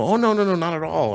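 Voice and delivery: imitated high-pitched, reassuring denial; hasty denial. Stranger reassuring the builder there's no problem, high-pitched protest.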